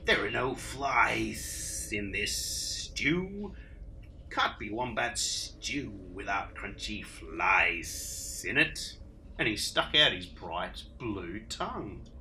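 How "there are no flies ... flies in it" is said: slow, lower sly lizard voice; hissing lizard. Acted. Blue Tongue sneers at Dingo, slow and low. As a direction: drag it out like a lizard.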